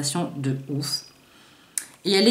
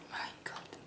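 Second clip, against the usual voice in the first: awed whisper; 22 dB quieter than usual. Real, not acted. Awed by the palette's mirror.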